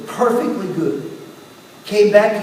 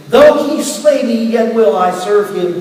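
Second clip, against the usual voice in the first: preaching; 3 semitones higher, 5 dB louder, slower than usual. Acted. Preacher quoting Job with fervor.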